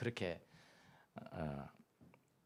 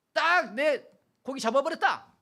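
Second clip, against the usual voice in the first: gloating spouse; 13 semitones higher, 8 dB louder, slower than usual. Retold or imitated. Voices spouse gloating after marriage.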